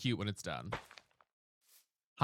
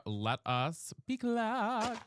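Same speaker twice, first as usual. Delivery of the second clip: mock solemn; 5 semitones higher, much slower than usual.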